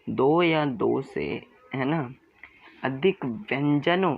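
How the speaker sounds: दो या दो से spelled out very slowly; slow each word of दो या दो से right down; slow emphasis. Shown as a demonstration. Teacher slowing down दो या दो से so students catch it. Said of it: "ध्यान से सुनिएगा".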